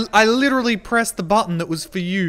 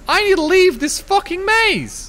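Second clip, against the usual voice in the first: high-pitched exasperated outburst; exasperated cry; 11 semitones higher than usual. Real, not acted. Streamer stuck in a maze, exasperated cry.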